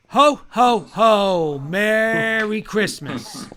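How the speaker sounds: booming, slow jolly Santa laugh; big belly Santa greeting. Pretend. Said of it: "I wanna hear your best one of these".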